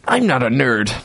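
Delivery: put-on young Sheldon voice. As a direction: rattle it off like a smug genius. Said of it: "said young Sheldon".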